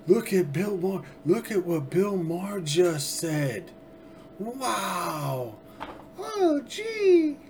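high, mock-amazed gasp of wonder; sarcastic. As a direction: fake astonishment, laying it on thick. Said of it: "You catch the sarcasm".

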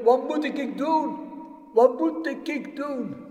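loud, high desperate cry upward; calling for help. Acted. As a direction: call up to heaven in panic. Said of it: "En hij riep".

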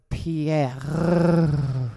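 Pierre drawn out with a long, loud rolled French R; French-style name. Shown as a demonstration. Gushing to listeners about a guy named Pierre, drawn-out French pronunciation. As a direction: roll and stretch the R in Pierre.

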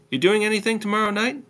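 put-on high, quick girlfriend question; high-pitched female character, rising question; girlfriend's voice. A narrator's reading. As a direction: pitch it up and ask briskly. Narrator voices girlfriend on phone.

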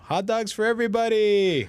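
big booming pitch, high and loud; hammed-up announcer shout. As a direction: sell it like a ballpark hype man. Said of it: "That was the whole presentation".

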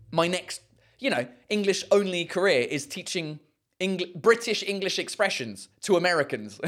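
ordinary delivery

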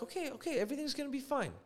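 soft, high-pitched reassurance; mock placating. Painters calming the angry homeowner, mock placating.

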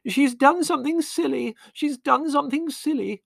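high, loud, far-off wail; frantic mother wailing at night. A narrator's reading. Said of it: "wailed Mother".

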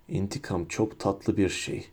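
soft, low, hushed threat; whispered. A narrator's reading. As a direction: savour the revenge in a hushed voice. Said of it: "diye fısıldadı Blake'e".

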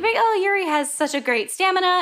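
high-pitched admiring commentator; mock admiration. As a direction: imitate impressed commentators, pitch lifted. Acting out admiring skating commentators.

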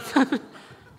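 high, loud laughing fit; real laugh. Real, not acted. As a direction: crack up mid-story. Laughing at his own retold story.

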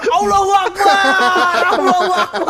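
slow, high, loud cry of Allahu Akbar; pretend shout. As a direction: shout it out in mock panic. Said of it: "Terus kamu akan teriak".

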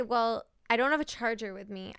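ordinary talk, nothing marked

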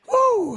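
high, loud "woo"; exhilarated whoop. Real, not acted. Preacher feels the force of the shouted climax, exhilarated whoop. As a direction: let out a sudden loud whoop.

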